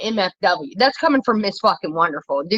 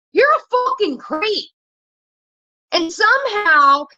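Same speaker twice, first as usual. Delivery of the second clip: outraged rant; 8 semitones higher than usual. Real, not acted. Outraged rant about cruel fans.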